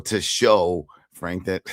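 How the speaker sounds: usual speaking voice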